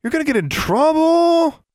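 put-on high, loud singsong little-girl warning; intoned taunt. A narrator's reading. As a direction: chant it like a schoolyard tattletale. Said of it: "intoned Sylvia".

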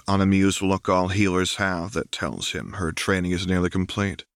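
usual speaking voice

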